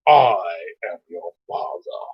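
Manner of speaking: loom over him in a deep voice; Darth Vader. Pretend. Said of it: "Darth Vader".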